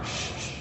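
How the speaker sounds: soft breathy shh to hush the class; shushing. Real, not acted. Lecturer shushing students before making a point, hushed. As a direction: hush the room gently.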